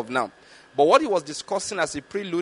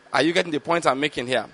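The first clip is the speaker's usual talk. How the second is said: ordinary talk, nothing marked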